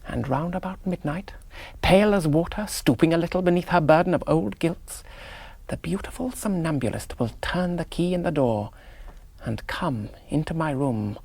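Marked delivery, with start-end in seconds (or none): none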